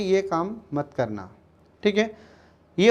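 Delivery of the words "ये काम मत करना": quiet, slow ये काम मत करना without stress; teacher showing a mild refusal to a child; soft, unforced no. Shown as a demonstration. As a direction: tell the child gently, no pressure. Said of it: "जोर लगाकर मना नहीं किया".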